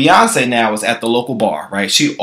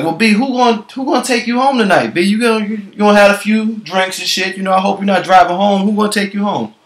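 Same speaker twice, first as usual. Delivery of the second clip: higher, friendly bartender voice; 8 semitones higher than usual. Retold or imitated. Bartender offering her a ride, higher and coaxing. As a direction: coax her sweetly, pitched up. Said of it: "So he's like".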